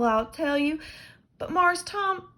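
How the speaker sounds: loud, high slow admiring voice; awed Jim. Acted. Jim admiring the marble but scared of missus. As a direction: marvel at the marble, then fear.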